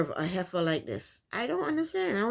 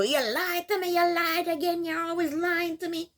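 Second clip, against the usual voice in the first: mock angry accusation; 8 semitones higher than usual. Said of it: "she's pissed at that".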